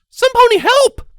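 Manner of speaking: shrill high nurse shout; crying out. Acted. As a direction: call for help urgently. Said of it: "the nurse at the front cried out".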